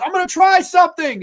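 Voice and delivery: high, loud imitation; hammed-up high-pitched call mid-chase; mock urgent announcement. Acted shout during car chase.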